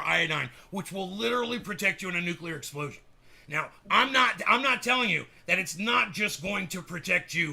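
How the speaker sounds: hammed-up ranting conspiracy host; Alex Jones. Host impersonating a radio ranter selling iodine, bombastic rant. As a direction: push the sales pitch in emphatic bursts, building to shouts. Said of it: "That's my, that's my A J".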